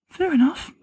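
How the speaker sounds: squeaky high. A narrator's reading. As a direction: pitch it up high. Reply to too high.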